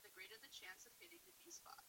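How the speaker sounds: soft unvoiced whisper. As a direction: hush it like a secret. Whispered tip.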